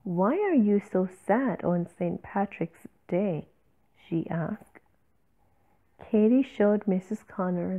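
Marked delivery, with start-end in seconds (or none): none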